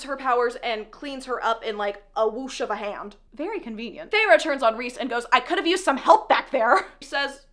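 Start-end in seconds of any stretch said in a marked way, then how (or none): 5.3-6.9 loud, acted complaint; mock indignant. Heroine turning on Rhys after escaping, mock indignant. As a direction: throw it at him in mock reproach.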